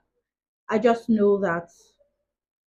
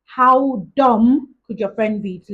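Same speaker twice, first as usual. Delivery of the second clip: louder, slowed-down exasperated complaint; retold anger; 3 semitones higher, 8 dB louder, slower than usual. Venting about the friend who lost her phone, mad. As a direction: lean hard and slow on the rebuke. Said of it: "I was just mad".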